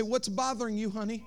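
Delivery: put-on soft, hushed comfort to a child; tender father. Father asking his crying daughter, very soft and tender. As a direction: kneel down and ask gently.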